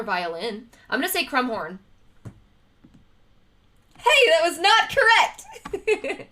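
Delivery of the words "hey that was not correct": high, slow, laughing outcry; laughing surprise. Real, not acted. Streamer laughing at her own wrong quiz answer. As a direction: caught out and cracking up.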